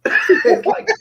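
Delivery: laughing hard, voice jumping up; high burst of laughter. Real, not acted. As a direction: crack up at the absurdity.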